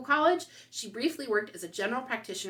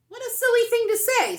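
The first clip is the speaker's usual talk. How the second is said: much higher and louder, scoffing; mother's indignant exclamation; 10 dB louder than usual. Acted. Mother to May about the teacher, a loud scoffing exclamation. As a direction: brush off the teacher's words.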